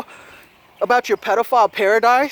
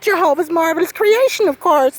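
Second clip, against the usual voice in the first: mock pious; 6 semitones higher than usual. Mocking pious answer.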